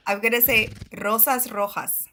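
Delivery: "rosas rojas" with trilled r and Spanish j; rolled R. Shown as a demonstration. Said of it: "roll the R".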